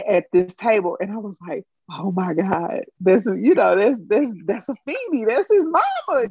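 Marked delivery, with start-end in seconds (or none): 1.9-6.3 retold starstruck gushing, climbing high; acting out an awestruck fan; fangirl moment. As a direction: build from awe to a high fangirl squeal. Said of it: "in my whole fangirl moment".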